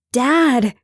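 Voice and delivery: loud high cry of Dad. A narrator's reading. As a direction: call out to her father. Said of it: "Chloe cried".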